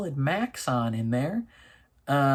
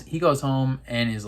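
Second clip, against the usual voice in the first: ordinary delivery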